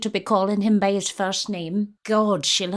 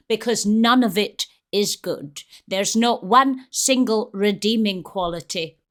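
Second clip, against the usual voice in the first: ordinary talk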